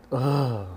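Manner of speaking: loud fed-up "ugh"; sharp annoyed ugh; exasperated groan. Retold or imitated. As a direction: let out a frustrated groan. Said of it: "It's like".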